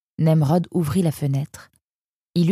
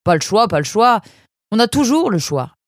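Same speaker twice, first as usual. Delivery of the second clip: loud, high exasperated comeback; indignant retort; 6 semitones higher, 6 dB louder than usual. A narrator's reading. Parent rejecting son's excuse of no choice, indignant. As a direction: throw the excuse back, voice raised.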